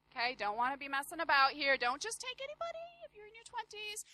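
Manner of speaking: ordinary talk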